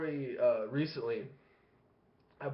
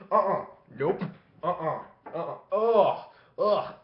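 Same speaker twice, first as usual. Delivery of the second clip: mock revolted grunts, building louder; disgusted refusal; 5 dB louder than usual. Dad fleeing a stink round the house, disgusted. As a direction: refuse it, then groan in loud disgust.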